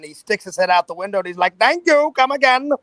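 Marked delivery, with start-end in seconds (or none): 1.6-2.7 high-pitched cheery worker voice; drive-thru worker. Retold or imitated. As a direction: call out a chirpy thank-you. Worker voiced in retold story.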